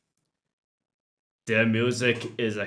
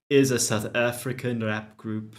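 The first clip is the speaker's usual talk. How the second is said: normal talking voice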